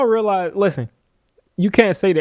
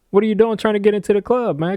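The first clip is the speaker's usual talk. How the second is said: higher and faster, piling on the disbelief; incredulous mock scolding; 7 semitones higher, faster than usual. To the undersized blitzer, a higher, faster incredulous scolding. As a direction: scoff at him like a bouncer turning away a kid. Mocking the small blitzer, again.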